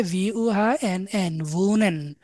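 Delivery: letter by letter, very slow; slow spelling. Shown as a demonstration. Teacher spelling a German word to students, very slow. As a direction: spell it out one letter at a time. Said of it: "তাহলে ভি দিয়ে".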